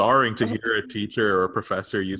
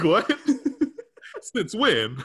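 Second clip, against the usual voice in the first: higher and louder, breaking into laughs; laughing disbelief; 12 semitones higher, 5 dB louder, much slower than usual. Retold or imitated. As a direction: gasp it out amused and shocked. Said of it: "And I was like".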